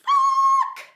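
huge high screamed curse; curse shout. Retold or imitated. Hearing the crash, a high screamed curse. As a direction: scream it at the crunch. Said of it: "And I was like".

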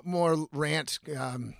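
ordinary talk

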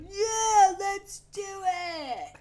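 high, loud, dumb eager cheer; mock excited boys. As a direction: drag out the hype, slow. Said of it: "they're just like".

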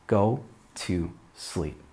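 a slow quiet good night; tender. Shown as a demonstration. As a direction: soothe them toward sleep. Gentle sleep instruction.

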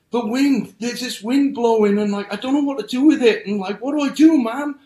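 put-on high, fast, rattled child voice; boy who ran home telling his mom about the wind, panicked and high. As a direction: blurt it all out to mom, scared and rushed.

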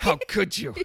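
hammed-up hurt outrage, pitched high; mock offended family. Retold family mocking her back.

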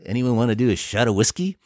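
fast, mumbled [name] impression; [name] impression. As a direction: toss it off like [name] offering a shot, quick. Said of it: "That's a good [name]".

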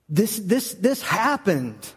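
ordinary talk

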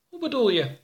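high character voice; high-pitched puzzled question; puzzled questioner. Acted. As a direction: ask high, falling at the end. Oroka questions the politician.

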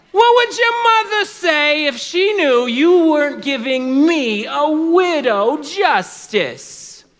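very high, loud, drawn-out appeal; desperate widow. Acted. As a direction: wail at the judge to shame him. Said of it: "would get desperate and she would try and play".

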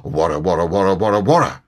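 rolling storybook tiger war cry; loud, playful animal noise; loud growl. A narrator's reading. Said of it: "and with one loud".

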